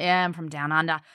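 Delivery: hammed-up Australian accent; over-the-top Aussie accent. Australian in America saying where she's from, hammed-up Aussie accent. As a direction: lay on the Aussie thick. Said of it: "I lean into the Aussie accent".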